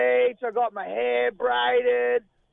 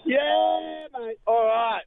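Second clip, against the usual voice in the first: normal talking voice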